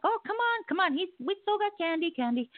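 coaxing call. Retold or imitated. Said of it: "and I'm like".

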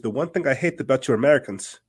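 a hint of a Polish Russian accent. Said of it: "I'm doing, like, a Polish Russian accent".

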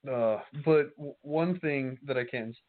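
talking through hiccups; hiccuping while eating. Real, not acted. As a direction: hiccups breaking the words. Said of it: "sorry, I keep hiccuping".

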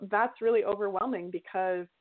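ordinary talk

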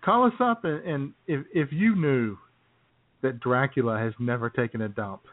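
ordinary talk, nothing marked

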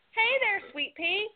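high, cooing greeting to a cute dog; sweet baby talk. Retold or imitated. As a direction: coo at the little dog sweetly. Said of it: "it growled at me and lunged at me".

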